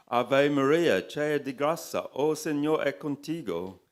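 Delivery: high, loud Portuguese recitation; Portuguese prayer. A narrator's reading. Narrator counters the devil with a Portuguese prayer, proclaimed. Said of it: "mentally thanking the Portuguese nun".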